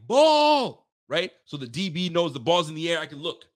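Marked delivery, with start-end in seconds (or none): 0.1-0.9 sharp high yell of Ball; full-voiced sideline shout. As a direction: bark it like a teammate calling the pass. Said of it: "the whole sideline's saying".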